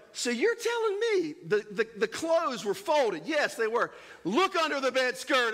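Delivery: spring the trap like a detective; hammed-up parent catching a child; mock triumphant parent. Parent exposing hidden laundry.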